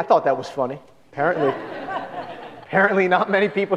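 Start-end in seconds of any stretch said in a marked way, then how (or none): 1.5-2.5 breathy laugh. Real, not acted. Laughs at his own joke.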